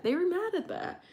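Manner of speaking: "mad" lifted high in disbelief. Shown as a demonstration. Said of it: "And they were mad at that".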